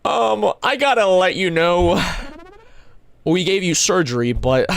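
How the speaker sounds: a lightly voiced doctor breaking bad news, slow; casual doctor owning up; mock doctor. Doctor telling a patient about a surgery. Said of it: "If I went to the doctor and they're like".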